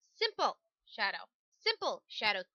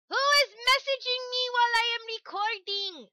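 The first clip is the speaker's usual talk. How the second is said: high, loud startled squawk; startled outburst; 12 semitones higher, 9 dB louder than usual. Real, not acted. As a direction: blurt it out in surprise. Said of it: "What was that coming out of my mouth?".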